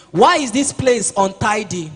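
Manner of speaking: put-on loud, demanding question at a messy child; scolding parent. Parent scolding child over an untidy place, loud demand. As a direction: bark the question like a fed-up parent.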